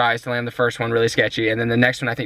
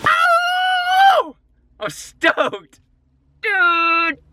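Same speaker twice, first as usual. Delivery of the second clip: excited; much slower than usual. Real, not acted. Landing the trick, celebrating.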